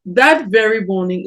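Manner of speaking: ordinary delivery, nothing marked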